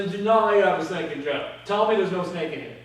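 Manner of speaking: ordinary talk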